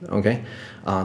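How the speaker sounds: ordinary talk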